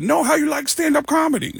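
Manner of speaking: very high, insistent imitation; high-pitched comedian voice; Tracy impression. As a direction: correct him in a high put-on voice. Retold Tracy correcting him.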